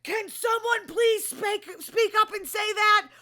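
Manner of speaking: loud, high, drawn-out shout; yelling. Retold or imitated. Recapping a housewife's outburst at a party, yelling. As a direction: yell it slowly for a whole party to hear.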